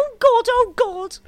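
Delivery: high, loud panicked wailing; hammed-up frightened cries; mock panic. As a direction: imitate someone crying out in terror. Mocking someone panicking on plane.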